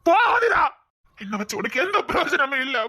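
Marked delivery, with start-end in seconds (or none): 0.1-0.6 sobbing yell, much higher and louder; crying shout. Acted. To Athira, begging her to leave, shouting through sobs. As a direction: break into a desperate cry. Paralysed man drives lover away.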